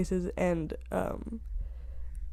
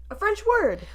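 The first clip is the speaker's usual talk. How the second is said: squeaky surprise; 12 semitones higher than usual. Real, not acted. Reading search result.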